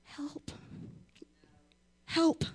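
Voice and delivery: faint whispered help; a weak, barely-there call for help; the wrong way to cry out; timid help. Shown as a demonstration. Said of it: "are you gonna be like".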